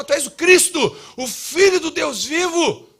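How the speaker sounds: shouted high, then slowing on o Filho do Deus vivo; Peter's bold declaration. Retold or imitated. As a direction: proclaim it like Peter leaping up. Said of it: "o Pedro salta e fala".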